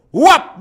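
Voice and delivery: a loud, high, sudden "Whap!" like a blade striking; mock chop sound. Host telling callers diabetics lose their feet, loud mock chop sound. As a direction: slap the sound out hard and fast. Imitating a foot being amputated.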